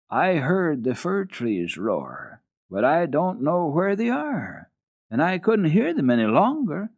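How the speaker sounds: put-on slow, dreamy little girl; a puzzled child, far away; wondering child. Heidi voiced, wondering child.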